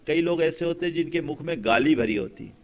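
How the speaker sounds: ordinary talk, nothing marked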